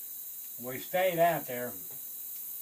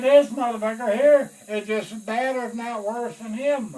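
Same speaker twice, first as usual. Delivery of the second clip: loud, high indignant objection; outraged colonel; 8 semitones higher, 13 dB louder than usual. Retold or imitated. Colonel loudly objecting to another pick.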